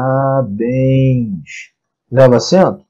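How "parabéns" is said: drawn-out "Parabéns", each syllable held long. Shown as a demonstration. Teacher sounds out the example word for students, drawn out slowly.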